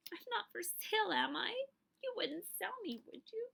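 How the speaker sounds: put-on high, slow, tearful child's plea; tearful pleading. Girl asks her dad if she's for sale, high tearful plea. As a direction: ask dad through mock tears, high. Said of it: "I'm really bawling".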